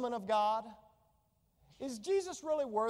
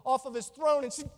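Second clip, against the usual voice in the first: ordinary talk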